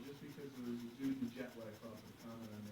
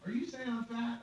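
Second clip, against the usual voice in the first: high, loud mock indignation; indignant; 11 semitones higher, 6 dB louder than usual. Passenger confronting the desk agent, indignant. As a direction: challenge it as if insulted.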